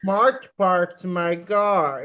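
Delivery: slow, drawn-out mock Boston accent; hammed-up fake Boston vowels; bad Boston accent. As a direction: exaggerate a botched Boston accent. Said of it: "trying to do a Boston accent".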